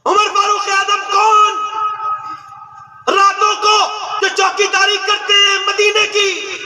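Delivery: ringing impassioned preacher; fiery preaching. Real, not acted. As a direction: rouse the congregation. Preaching at full force.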